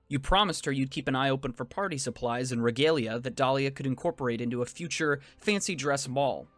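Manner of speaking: ordinary delivery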